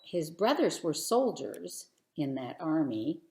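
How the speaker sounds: ordinary delivery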